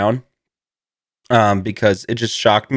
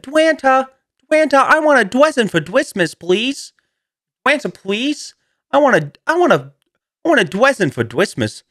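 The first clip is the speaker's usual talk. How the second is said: put-on high pleading child with w for r; lisping little kid; 12 semitones higher than usual. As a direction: beg like a small kid lisping. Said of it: "like a kid with a speech impediment".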